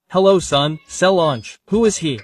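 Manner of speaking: usual speaking voice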